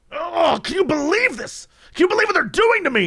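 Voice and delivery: high-pitched mock disbelief and outrage; hammed-up exasperated wail; imitated indignant protest. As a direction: whine it up high as an outraged complainer. Acting out furious man's protest to police.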